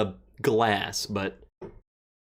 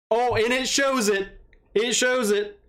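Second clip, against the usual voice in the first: excited; 8 semitones higher than usual. Real, not acted. Goal shows on screen.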